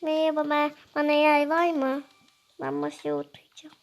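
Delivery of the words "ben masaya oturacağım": soft unvoiced whisper, sneaking to the table. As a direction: breathe it as a secret.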